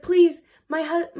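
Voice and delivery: high, drawn-out mock plea; hammed-up begging; pleading husband. Husband begging the witch for mercy.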